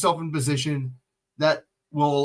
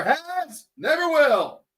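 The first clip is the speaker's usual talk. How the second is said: sudden higher shout; 8 semitones higher, much slower than usual. Real, not acted. Ranting about referees, shouting the final words. Ranting that refs never favour you.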